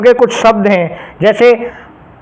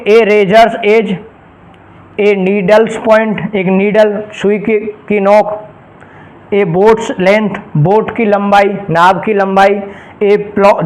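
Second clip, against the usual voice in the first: slow, careful classroom example; slow dictation; slower than usual. Shown as a demonstration. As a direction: spell out each example very slowly. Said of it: "teacher dictating slow examples".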